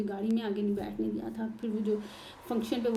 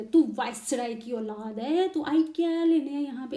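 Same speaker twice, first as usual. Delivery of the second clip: slow, then higher louder sneering father-in-law; mocking taunt; 3 semitones higher, 6 dB louder than usual. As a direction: taunt her with contempt. ससुर का ताना. (ससुर की नकल में ऊँची आवाज़ से ताना)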